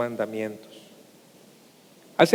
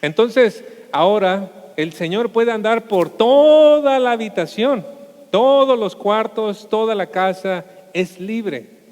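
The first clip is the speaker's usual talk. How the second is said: triumphant climax; 6 semitones higher, 6 dB louder, slower than usual. Real, not acted. Climax of the sermon story.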